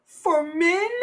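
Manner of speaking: high, drawn-out condescending imitation; talking down. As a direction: mimic someone explaining to a slow child. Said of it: "like you were talking to a slow entity".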